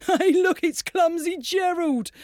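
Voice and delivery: high, mocking sneer; sneering animals. A narrator's reading. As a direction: jeer at him like a schoolyard bully. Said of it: "the animals all sneered".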